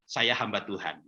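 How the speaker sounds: soft declaration. Acted. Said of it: "Nanti bapak bilang sama anaknya".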